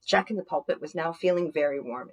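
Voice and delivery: plain reading voice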